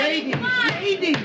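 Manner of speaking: high calls over a noisy room; calling for order. Acted. Captain calls the rowdy women to order, pitched high.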